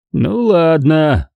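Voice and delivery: slow, reluctant giving-in; sighing heavily. A narrator's reading. Father gives in to son, sighing heavily. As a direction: agree with a weary, drawn-out sigh. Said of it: "тяжело вздыхая".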